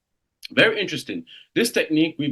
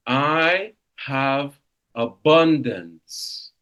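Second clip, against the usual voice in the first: "I have abundance" drawn out slowly, each word weighted; slow affirmation; 4 semitones higher, 5 dB louder, much slower than usual. Shown as a demonstration. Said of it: "I said".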